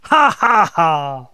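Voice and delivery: high, loud laughter easing off; hearty laugh. A narrator's reading. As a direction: burst out laughing high, then come down. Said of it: "laughed Fred".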